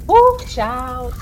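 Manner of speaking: high, loud "ooh" from the heat; overheated cry of relief; hot flush exclaim. Real, not acted. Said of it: "guys, it's hot".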